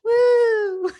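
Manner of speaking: high, loud whoop of welcome; excited woo. Real, not acted. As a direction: cheer the guest in. Host cheering the guest's welcome.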